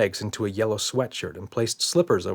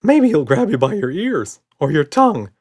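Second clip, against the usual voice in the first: higher and louder warning; anxious monster; 8 semitones higher, 8 dB louder than usual. A narrator's reading. Red warns Buddy, high and loud. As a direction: nervously warn his friend.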